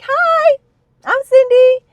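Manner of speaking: high, loud, bubbly chirp, like it's all a joke; overly cheery hello. Retold or imitated. Greeting the therapist at the first session, high and bubbly. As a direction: play the sunny new patient, loud and high.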